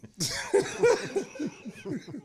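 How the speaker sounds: high, bursting laugh; big laugh. Real, not acted. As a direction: laugh out loud at his own joke. Laughs at Rolex joke.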